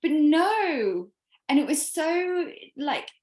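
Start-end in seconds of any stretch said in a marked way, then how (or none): 0.0-1.1 loud, high-pitched retold dismay; dismayed realisation. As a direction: re-enact a shocked realisation, voice raised. Acts out her dismayed realisation.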